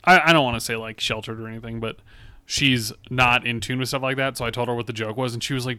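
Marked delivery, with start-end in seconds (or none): none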